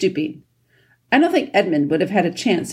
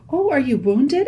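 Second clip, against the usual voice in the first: high-pitched, worried question; concerned; 8 semitones higher than usual. A narrator's reading. Lucy asks the wounded dwarf, high and concerned. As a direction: ask with sudden concern, voice lifted. Lucy learns Trumpkin is hurt.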